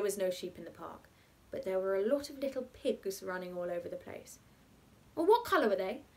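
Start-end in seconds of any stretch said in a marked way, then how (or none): 5.1-6.0 put-on higher, louder child's question; a hint of an eager child. A narrator's reading. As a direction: ask like an impatient child. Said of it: "child asking about the pigs".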